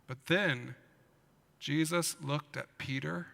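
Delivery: usual speaking voice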